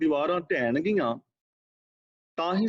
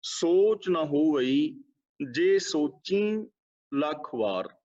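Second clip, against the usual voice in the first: very slow, drawn-out recitation of the verse; slow, devotional reading of the Gurbani line; slow scripture recitation; much slower than usual. Shown as a demonstration. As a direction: recite each phrase slowly with long pauses. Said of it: "ਆਓ ਅਸੀਂ ਪਹਿਲਾਂ ਪੜ੍ਹਦੇ ਹਾਂ".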